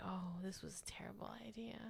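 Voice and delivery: hushed unvoiced whisper. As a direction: breathe it out as a whisper. Mocking own past reaction.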